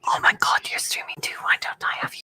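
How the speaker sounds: soft hushed whisper. Real, not acted. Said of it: "Why are we whispering?".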